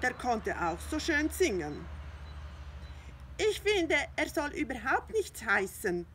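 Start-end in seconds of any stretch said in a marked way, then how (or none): 3.4-6.1 high, loud, sulky cat; grumpy Findus. Acted. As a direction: grumble it like a jealous cat. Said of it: "sagte Findus mürrisch".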